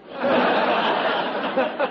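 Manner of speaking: high, loud cackle at his own joke; high laugh. Acted. Undertaker laughing at his own joke, high cackle.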